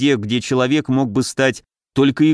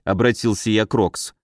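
ordinary delivery, nothing marked

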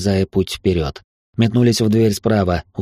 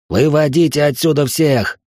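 high, raised urgent cry; yelling an order; 7 semitones higher than usual. A narrator's reading. Ordering men to evacuate the hall, urgent yell. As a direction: yell it over the panic. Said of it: "заорал я".

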